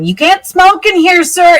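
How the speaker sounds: high, loud scolding yell. Acted. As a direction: yell a reprimand at a rule-breaker. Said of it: "yelling at him".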